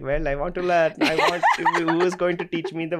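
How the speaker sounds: hammed-up Indian accent through laughter. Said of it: "I have, like, a thick Indian accent".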